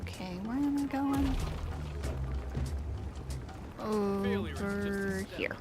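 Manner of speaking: usual speaking voice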